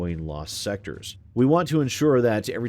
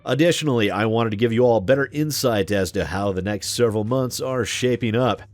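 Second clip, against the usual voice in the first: announcer voice. Acted. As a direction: hype it up like an arena announcer. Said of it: "I'm going to read this in my announcer voice".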